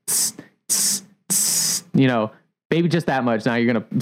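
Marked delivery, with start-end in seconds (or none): 0.1-1.8 short unvoiced hissing bursts like a steam wand; imitated steam hiss. Barista showing how the milk steamer sounds, soft hissing. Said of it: "you're gonna be like".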